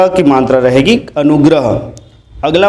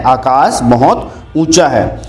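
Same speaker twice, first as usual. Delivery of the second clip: slow model sentence; 3 semitones lower, slower than usual. Shown as a demonstration. Teacher gives class corrected sentence, slow. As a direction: lay out the corrected sentence slowly. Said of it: "बोला जाता है".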